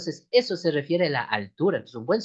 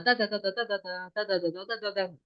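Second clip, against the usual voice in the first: quick rhythmic ta-ta beat; fast mouth rhythm; vocalized fast beat; 5 semitones higher, 5 dB quieter than usual. Shown as a demonstration. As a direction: imitate a faster band rhythm. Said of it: "como que más rápido".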